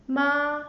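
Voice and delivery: "ma" held high and level in pitch; "ma" sung out flat and high, no rise or fall; first tone ma. Shown as a demonstration. Teacher drilling the first tone, "ma" high and level. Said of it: "The first tone".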